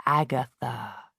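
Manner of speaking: low, soft spectral call; ghostly voice. Acted. Ghost of Magnus calling Agatha, low and eerie. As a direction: summon her slowly from beyond.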